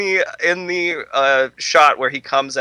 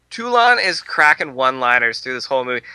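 normal talking voice

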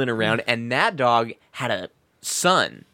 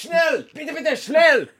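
high, barking mock German commands; German dog owner; 4 dB louder, slower than usual. Mocking a German owner ordering his dog, high bark.

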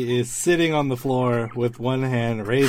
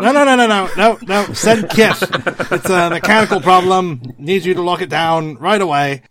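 louder, higher, flustered no-no-nos; flustered protest; 3 semitones higher, 5 dB louder than usual. Acted. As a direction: panicky refusal, flapping. Matu flustered refusing help.

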